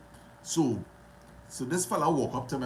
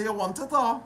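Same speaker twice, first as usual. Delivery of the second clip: eager offer; 11 semitones higher, 4 dB louder than usual. Retold or imitated. Retold dialogue giving chain away.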